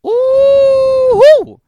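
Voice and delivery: very high, loud ooh squeal; a piercing excited ooh; delighted squeal. Real, not acted. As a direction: shriek with glee.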